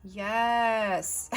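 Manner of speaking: ordinary delivery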